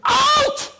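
sudden high, loud shout; yelled command. Acted. Owner wanting the dog to stop, explosive acted yell. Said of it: "we're gonna start yelling at the dog".

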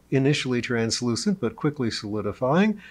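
usual speaking voice

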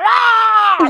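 a high, loud imitated roar of the crowd. The prisoners turning on the band, a high loud roar. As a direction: roar like the whole gym at once. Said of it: "Everybody's going".

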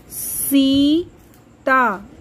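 Sita with the सी held long; slow stretched long ई. Shown as a demonstration. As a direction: sound out Sita slowly. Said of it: "आवाज़ थोड़ी सी लंबी". (सीता धीरे खींचकर)

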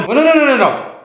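high, rapid-fire protest; incredulous objection. Acted. Dad interrupting his wife, incredulous. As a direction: cut in with disbelief.

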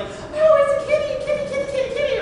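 put-on high, loud, delighted troll-child coo; troll kid cooing. Little troll kid cooing at a bear, high and delighted. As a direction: coo at the big kitty like a tiny troll. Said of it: "Little troll kid finds the bear and is like".

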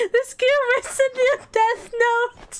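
high, squeaky, helpless laughing fit; laughing breathless. Real, not acted. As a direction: gasp the words out between bursts of laughter. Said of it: "I'm trying to breathe".

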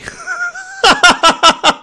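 high squealing laugh. Real, not acted. Reader cracks up.

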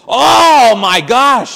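loud, slow drawn-out amazement; young man overjoyed at the priest's offer; amazed. Retold or imitated. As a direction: let it burst out in disbelief. Boy thrilled at selfie offer.

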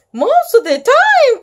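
loud, high-pitched cry of dismay. A narrator's reading. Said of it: "cried Pete".

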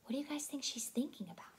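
quick whispered aside. Real, not acted. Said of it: "whispered aside to listeners".

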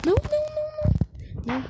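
high frantic no no no; panicked screaming. Real, not acted. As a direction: scream as if caught. Zombie about to catch him.

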